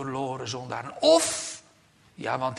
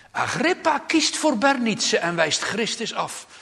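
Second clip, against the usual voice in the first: forceful proclamation; 11 semitones higher, 10 dB louder than usual. Real, not acted. Preacher proclaiming judgment.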